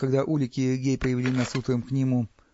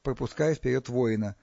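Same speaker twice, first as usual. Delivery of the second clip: ordinary delivery, nothing marked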